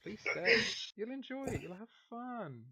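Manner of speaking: coaxing madam. Acted. Charmed madam coaxes.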